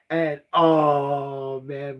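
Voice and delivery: slow, choked-up sigh of emotion; real choked-up crying. Real, not acted. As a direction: let the tears catch in the words. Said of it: "I start crying".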